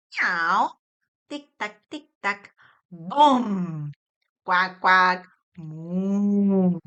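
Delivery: playful meow, ticking and moo; animal and object sounds. Shown as a demonstration. Therapist modelling onomatopoeia for a child, playful. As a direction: imitate animals for a toddler. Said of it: "Sonidos onomatopeicos".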